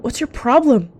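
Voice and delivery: loud, high indignant demand; angry outburst. A narrator's reading. Clawed cat to their attacker, angrily demanding. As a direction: throw it back at the attacker, loudly. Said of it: "Vulturefall hissed".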